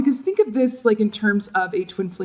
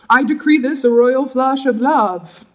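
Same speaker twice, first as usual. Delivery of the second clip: higher, slow, loud playful decree; mock royal proclamation; 4 semitones higher, 5 dB louder, slower than usual. Reader declares a royal flush, mock-grand proclamation.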